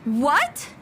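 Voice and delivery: sudden high startled what; jolted out of a daze; bumped warlock caught off guard, startled. Acted. Startled after being bumped.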